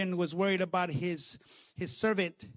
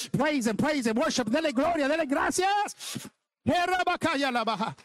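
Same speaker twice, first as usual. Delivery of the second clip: high, driving revival preaching; fervent exhortation; 5 semitones higher than usual. Real, not acted. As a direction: urge the congregation into praise. Exhorts congregation to praise.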